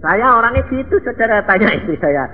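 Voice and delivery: high, loud fiery sermon; impassioned shouting preacher; preaching at full force. Real, not acted. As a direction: drive the point home to the congregation. Preaching burst.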